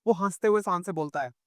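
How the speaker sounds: ordinary delivery, nothing marked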